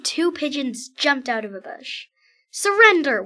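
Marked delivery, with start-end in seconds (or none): 2.5-3.2 loud high command; shouted order. Acted. Pigeon ambushes the king, loud high demand. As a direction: bark it at the prisoner. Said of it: "one pigeon said".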